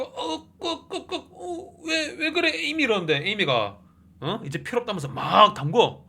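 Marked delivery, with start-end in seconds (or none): 0.0-2.8 high, strangled gasps breaking into words; choking while stabbed. Acted. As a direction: croak out a dying, bewildered question in high pitch. Stabbed man gasping out last words. (칼에 찔려 숨넘어가는 높은 목소리)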